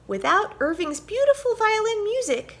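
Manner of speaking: high, loud squeaky mouse voice; mouse squeak. A narrator's reading. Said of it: "squeaked Lizzie Mouse".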